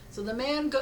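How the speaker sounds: usual speaking voice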